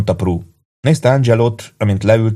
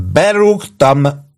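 put-on high, very slow drunken bellow; slow, high drunk holler; drunken howl; 9 semitones higher, 4 dB louder, much slower than usual. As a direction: howl it out, proud of being drunk. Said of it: "üvöltötte Angelo".

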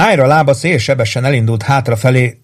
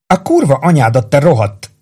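higher-pitched, narrated angry swearing; angry hiss; 4 semitones higher than usual. A narrator's reading. Rose swearing at Warden as he holds her, angry hiss. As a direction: spit the curse out, pitch raised. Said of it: "sziszegte dühösen Rose".

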